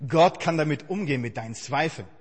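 normal talking voice

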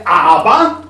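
ein lautes, gedehntes Aber; plötzlich laut. Retold or imitated. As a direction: das Aber laut herausstoßen. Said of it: "Der Mensch glaubt". (lauter Einwurf des Stolzen)

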